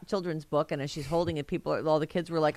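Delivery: usual speaking voice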